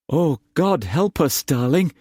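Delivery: put-on young wife crying out in fear to her husband; frightened cry. A narrator's reading. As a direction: cry out to him for help. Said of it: "Tess cried to Cullum".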